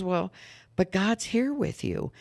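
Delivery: high, slow reassuring mother. Retold or imitated. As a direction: soothe a scared child slowly. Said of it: "And she says".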